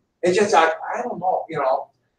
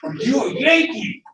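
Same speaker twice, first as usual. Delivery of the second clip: slow, higher-pitched insistent challenge; mock angry; 8 semitones higher, slower than usual. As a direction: imitate an angry man repeating his question. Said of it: "he's mad".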